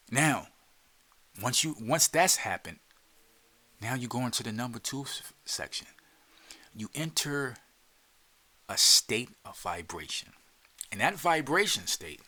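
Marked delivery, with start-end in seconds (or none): none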